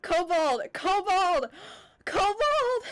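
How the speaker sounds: high, loud, thrilled calling out; excited shout. Real, not acted. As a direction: shout the name with delight. Excited shout at a long-time subscriber.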